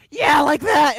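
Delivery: loud, high cheer of approval; teacher's encouraging shout, acted out; excited teacher. Voice teacher approving a loose student, excited mock shout. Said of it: "She's like".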